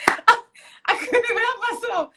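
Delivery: high-pitched, laughing through the words; real laughter breaking into speech. Real, not acted. Laughing at her own story.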